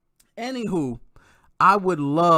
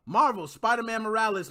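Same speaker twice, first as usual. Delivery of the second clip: normal talking voice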